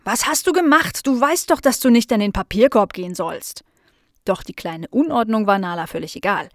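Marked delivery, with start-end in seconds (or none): 0.0-3.6 indignant telling-off, starting high; scolding. Acted. Tante Miriam finds paper all over the kitchen, scolding. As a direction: scold the naughty dog. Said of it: "schimpfte sie".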